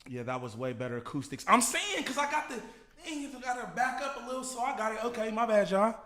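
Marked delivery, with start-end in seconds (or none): 1.5-5.9 high voice. Acted. High-pitched singing bit.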